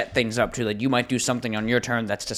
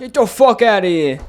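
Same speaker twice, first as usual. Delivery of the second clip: put-on loud high New York accent yell; 10 semitones higher, 9 dB louder than usual. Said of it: "New York or Boston accent".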